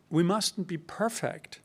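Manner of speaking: ordinary talk, nothing marked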